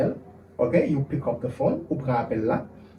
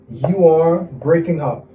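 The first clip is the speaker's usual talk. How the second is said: slow, drawn-out teaching delivery; measured language-teacher pace; slow model phrase; slower than usual. Shown as a demonstration. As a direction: spell out each word for learners. Teacher models English phrase slowly.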